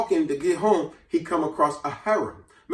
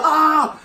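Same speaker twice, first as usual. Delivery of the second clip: mock scream; 10 semitones higher, 6 dB louder than usual. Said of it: "now she's screaming".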